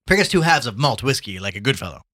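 loud, breezy drink order; hearty Gallagher, ordering. Acted. Gallagher orders malt whiskey from the barman, hearty.

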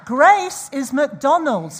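loud, slow declaration; loud proclamation. Real, not acted. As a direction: proclaim it slowly and loudly. Punchline proclaimed loudly.

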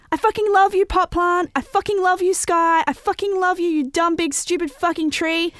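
high, loud, gleeful shouting; exuberant yelling. A narrator's reading. Girl runs around telling everything, yelling exuberantly. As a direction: shout it giddily at everything in sight.

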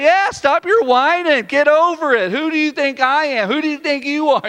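loud, high indignant rebuke; acted exasperated telling-off; God. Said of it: "He's like".

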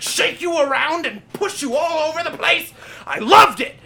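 loud, high, thrilled raving about the seats; excited shout. Real, not acted. A movie fan tells his cohost about D-Box seats, shouting with excitement.

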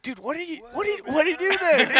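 high-pitched amazed stoner voice; a dazed listener, baffled and impressed. Retold or imitated. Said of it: "people be listening to this and be like".

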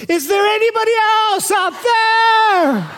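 high, slow, drawn-out shout; desperate shout. Acted. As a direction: call out, frantic and drawn-out. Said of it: "punchline, man calls again".

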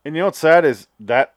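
ordinary talk, nothing marked

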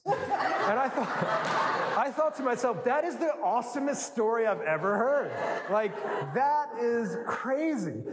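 high, breathless laugh-talk; laughing through it. Real, not acted. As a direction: laugh while telling the punchline. Laughing retelling the funny story.

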